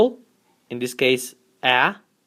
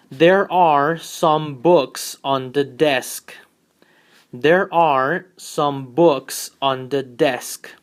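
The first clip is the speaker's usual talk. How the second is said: very slow, clear model sentence; much slower than usual. Shown as a demonstration. Teacher reading the affirmative example slowly. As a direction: read it out very slowly. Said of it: "let's analyze the first one".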